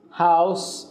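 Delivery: "هاوس" ending in a clear unvoiced s; one-syllable "هاوس" with final s; singular with S sound. Shown as a demonstration. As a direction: end "هاوس" on a hissed s sound.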